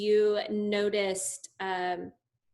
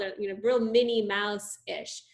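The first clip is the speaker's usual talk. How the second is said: ordinary delivery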